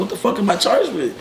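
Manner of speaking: high-pitched, baffled demand; retold incredulous outburst. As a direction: replay your disbelief at the charge. Retold shock at bond amount.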